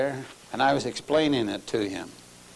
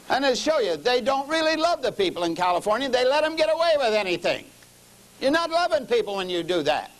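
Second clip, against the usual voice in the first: raised, higher preaching voice; preaching at full force; 8 semitones higher than usual. Acted. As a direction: drive it home from the pulpit.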